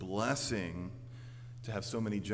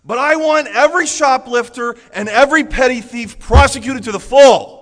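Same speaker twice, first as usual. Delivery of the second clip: shouted climax; 23 dB louder than usual. Acted. Hypocrite's demand, climax.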